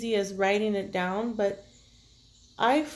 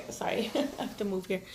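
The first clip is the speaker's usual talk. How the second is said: whispered aside; 5 dB quieter, faster than usual. Real, not acted. Aside while shifting position.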